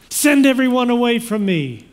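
high, loud, commanding cry. A narrator's reading. Joseph dismissing everyone before revealing himself, crying out loudly. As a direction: cry it out across the room as an order. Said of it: "and he cried out".

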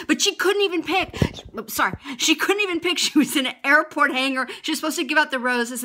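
normal talking voice

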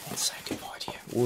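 unvoiced whisper. Real, not acted. Admiring the packing while unboxing, whispered. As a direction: whisper it to the camera.

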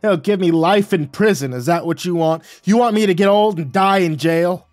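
loud, high desperate holler. Acted. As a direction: beg and shout at once. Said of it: "he hollered".

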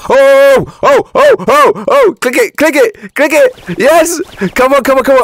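excited screaming. Real, not acted. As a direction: yell it out like you just won the jackpot. Big hatch finally comes through.

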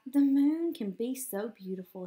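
usual speaking voice

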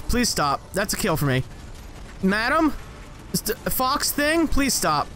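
ordinary delivery

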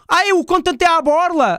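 high, sing-song mocking of a viewer's excuse; mock freeloader viewer. Streamer mocking viewers who think content is free, high and teasing. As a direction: mimic the cheapskate viewers, teasing them.

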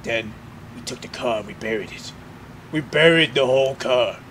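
mock cinematic narration. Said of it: "We're gonna have a cinematic view, like".

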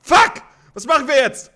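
high, loud panicked screams; real gamer rage screams; screaming in panic. Real, not acted. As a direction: scream at the screen as you die. Gunfight, out of ammo.

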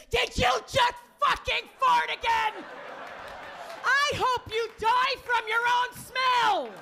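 high, shouted exasperation; furious mock shout. Fed-up partner after two years, furious shouting. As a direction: yell it in hammed-up rage.

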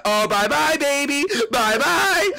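high gloating send-off; excited, high-pitched teasing farewell; gleeful taunt. Real, not acted. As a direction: crow at a beaten rival. Taunts rival being knocked out.

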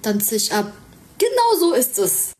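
ordinary talk

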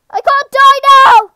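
high, loud desperate cries; panicked yelling. Real, not acted. Player about to lose the boss fight, loud and high panic. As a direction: yell in a gamer's desperation.

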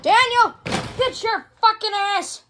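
high, slow, hollered command; exasperated high holler; real yell. Real, not acted. Yelling at Daniel, escalating.

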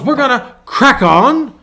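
cheerful British renovator urging everyone on; imitating British accent. Pastor imitating a British YouTuber's sign-off in a British accent. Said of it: "he goes".

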